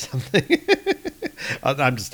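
plain reading voice

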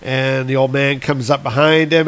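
ordinary talk

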